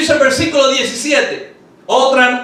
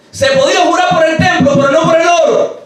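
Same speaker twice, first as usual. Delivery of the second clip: usual speaking voice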